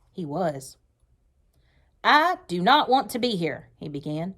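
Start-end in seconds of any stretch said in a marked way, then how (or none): 2.0-3.7 loud, stern, higher-pitched officer's voice; a furious commander laying down the law; angry commander. Acted. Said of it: "He looked really mad".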